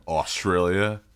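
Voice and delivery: broad Aussie vowels in Australia; Australian accent. Retold or imitated. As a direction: say Australia like a Queenslander. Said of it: "kids' Australian accents".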